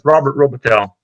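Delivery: Robitaille ending in a sounded L, like "tell"; New York pronunciation. Real, not acted. Candidate confirming his surname for the board, New York pronunciation. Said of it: "more of the New York's".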